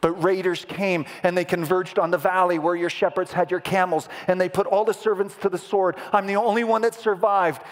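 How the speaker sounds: high, climbing report of disaster; urgent messenger. Acted. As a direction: build the alarm phrase by phrase. Acts next servant bringing bad news.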